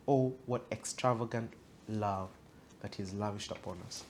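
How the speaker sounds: normal talking voice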